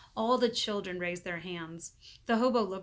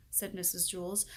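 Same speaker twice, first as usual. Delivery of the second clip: ordinary delivery, nothing marked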